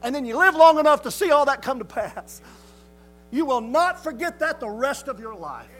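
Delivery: building to a loud, high sermon climax; fervent preaching. Real, not acted. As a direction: swell with conviction, phrase upon phrase.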